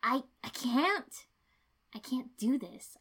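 the words escape before she can stop them; desperate. A narrator's reading. Shikako breaks down to Ino about nearly dying, desperately. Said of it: "edge of desperation leaking into my voice".

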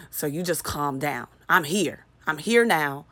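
normal talking voice

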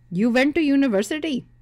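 put-on Indian accent. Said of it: "ones with thick accents and head bobbing".

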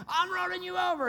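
imitating his fuming young self; mock furious kid. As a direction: snort it out like a raging bull. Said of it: "smoke coming out of my nostrils".

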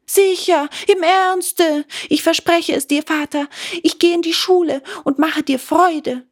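put-on high, eager wooden boy begging; pleading puppet. A narrator's reading. Puppet begging father for new feet, high and eager. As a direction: plead earnestly as the little puppet.

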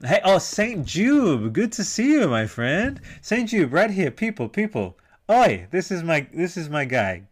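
loud, high, swooping welcome; excited greeting. Real, not acted. Host hailing a regular in the chat, excitedly.

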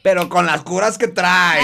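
put-on slow northern Mexican accent. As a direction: drawl it in a norteño accent. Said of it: "ese fue tu acento norteño".

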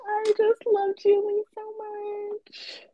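high, slow hammed-up gush; dramatic. Real, not acted. Hammed-up gushing about her friend Julie. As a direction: overdo the emotion playfully. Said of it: "Okay, dramatic ass".

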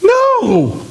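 very high sharp No!; a high emphatic refusal. Real, not acted. Emphatic rebuttal.

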